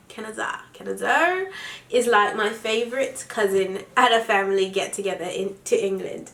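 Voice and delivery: put-on trying out a British accent while reading. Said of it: "read it in my British accent".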